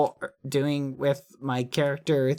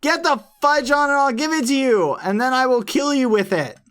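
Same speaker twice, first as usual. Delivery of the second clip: high, loud exasperated rant; heated, high-pitched outburst; angry yelling; 11 semitones higher, 6 dB louder than usual. Pretend. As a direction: yell back at a pestering friend. Yelling back at Quackity in game.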